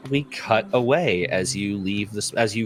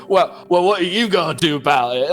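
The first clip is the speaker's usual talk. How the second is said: loud, higher macho challenge; toxic macho villager; 7 semitones higher, 5 dB louder than usual. Acted. Villager challenges the adventurer, mock macho and loud. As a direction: throw down a mock tough-guy challenge. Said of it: "some of that toxic masculinity".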